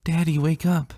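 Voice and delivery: put-on high, eager child voice; a small girl nudging someone awake, pitched high; little girl voice. A narrator's reading. Daughter waking her father in bed, high eager child voice.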